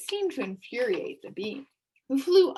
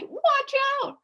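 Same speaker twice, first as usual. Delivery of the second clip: very high, loud warning; urgent cry; 8 dB louder than usual. A narrator's reading. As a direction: shout it before it's too late. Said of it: "Then he cried".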